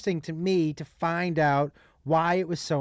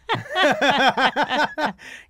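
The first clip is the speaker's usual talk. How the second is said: burst of laughter; 7 semitones higher, 5 dB louder than usual. Real, not acted. Laughs at own joke.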